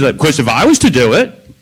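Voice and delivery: ordinary talk, nothing marked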